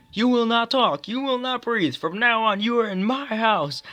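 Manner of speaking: loud, high-pitched barked orders; drill sergeant. Acted. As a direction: bark it like a drill sergeant at recruits. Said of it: "the drill sergeant in this film".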